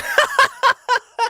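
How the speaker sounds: high burst of laughter; quick high cackling laugh. Real, not acted. Laughing at own mistake.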